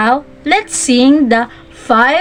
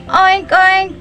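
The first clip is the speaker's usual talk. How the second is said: high nasal-free oink oink; pig oink; 4 semitones higher than usual. Shown as a demonstration. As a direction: grunt like a piglet. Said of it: "Can you say it again?".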